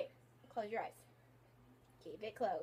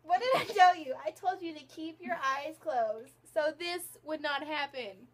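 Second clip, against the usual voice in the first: louder and higher, hammed-up scold; mock scolding, higher; 4 semitones higher, 12 dB louder than usual. Makeup artist scolding her friend, hammed-up anger. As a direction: push the fake anger up a notch. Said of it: "I'm so mad right now".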